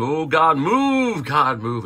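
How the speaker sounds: imitating a loud, high, repetitive pleading prayer; overdone fervent pleading, mocking; mock prayer-meeting wail. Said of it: "we're saying".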